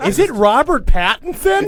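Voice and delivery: high, loud squealing fan question; squealing fan girl. Pretend. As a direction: squeal it like a smitten teen girl.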